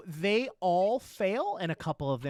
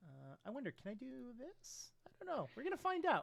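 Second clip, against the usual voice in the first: faint, off-mic voice; turned away from the microphone; 6 semitones higher, 14 dB quieter than usual. Real, not acted. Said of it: "every time you turn your face away from the microphone".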